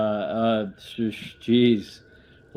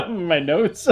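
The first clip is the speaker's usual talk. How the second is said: laughing hard, high and loud; real laughter; 10 semitones higher, 8 dB louder than usual. Real, not acted. Player deleting the NPC from his notes, laughing. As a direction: crack up mid-sentence.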